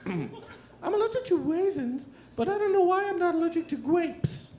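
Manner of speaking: put-on very high squeaky little boy voice; six-year-old boy voice. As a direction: play a whiny kid, way up high. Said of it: "here's my impression of a six-year-old boy".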